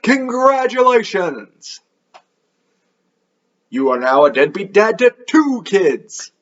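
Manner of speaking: high, drawn-out hammed-up cheer; mock congratulations. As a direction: announce it like a prize, with mock delight. Mock announcement of the pregnancy.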